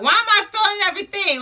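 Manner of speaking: high, hurried retold panic; freaking out. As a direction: rush it out in mock alarm. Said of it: "I start freaking out".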